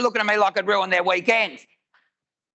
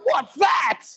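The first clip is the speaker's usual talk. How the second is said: disgusted shout; 6 semitones higher than usual. Retold or imitated. Girls smell the poo.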